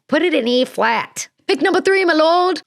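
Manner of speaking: high, sing-song fairy godmother voice. Retold or imitated. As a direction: purr it like a sweet scheming fairy. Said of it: "Shrek Two fairy godmother's voice".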